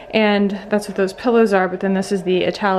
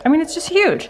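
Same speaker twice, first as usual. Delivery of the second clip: much higher, amazed emphasis on huge; excited; 9 semitones higher than usual. Real, not acted. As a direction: gush about how huge it is. Repeats phrase with more emphasis.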